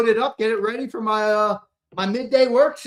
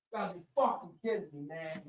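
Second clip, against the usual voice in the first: quick, much softer, under his breath; fast mutter; 13 dB quieter, faster than usual. Real, not acted. Streamer grumbling at chat under his breath, fast mutter. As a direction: grumble it to himself.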